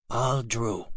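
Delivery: usual speaking voice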